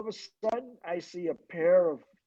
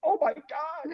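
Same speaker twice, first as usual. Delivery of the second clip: much higher, mock-startled echo; retold shock; 9 semitones higher, slower than usual. Man realizing he's in the ladies' room, retold shock. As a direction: squeal it as an acted-out shocked reply. Acting out his own shocked reply.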